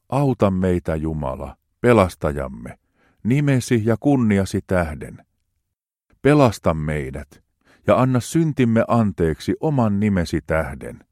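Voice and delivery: hartaasti, rauhallisesti luettu psalmi; juhlallinen psalmin luenta. Acted. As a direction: lue kuin rukousta jumalanpalveluksessa. Reads psalm aloud solemnly. (juhlallinen raamatunluku)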